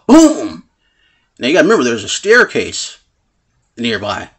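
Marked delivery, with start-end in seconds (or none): 0.0-0.6 a loud, higher "boom" for the push; mock shove boom. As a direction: punch the sound like a sudden shove. Retold shove near stairs.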